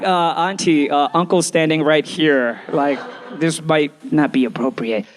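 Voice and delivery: low, hesitant polite protest; awkward objection. Acted. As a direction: object carefully, with uncle nearby. Jay awkwardly objects to the auntie.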